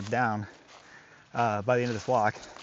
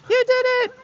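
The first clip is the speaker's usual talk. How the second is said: much higher, louder dog praise; high-pitched praise; 4 dB louder than usual. Real, not acted. Trainer praises his dog crossing, squeaky high praise.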